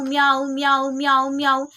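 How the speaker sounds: repeated meow, sung out like a cat; mock cat meowing. Storyteller mimics the cat's endless meowing, playful. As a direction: play the cat that never stops meowing.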